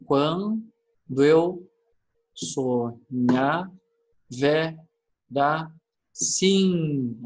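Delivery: "Quando eu sonhar, verá sim" chanted slowly in separate, stretched syllables; deliberate, full-voiced scansion of "Quando eu sonhar, verá sim", pausing between syllables. Shown as a demonstration. Teacher redoing the split for students, "Quando eu sonhar, verá sim" syllable by syllable. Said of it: "Depois a gente vai separando".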